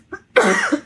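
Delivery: sharp high cough; dry cough. Real, not acted. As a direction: cough to clear a dry throat. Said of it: "I need some water".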